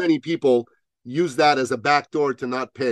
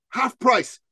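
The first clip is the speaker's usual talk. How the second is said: ordinary talk, nothing marked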